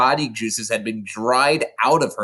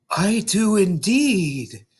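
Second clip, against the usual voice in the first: cook voice; 8 semitones higher, much slower than usual. Acted. Cook's voice in story.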